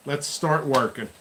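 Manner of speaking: usual speaking voice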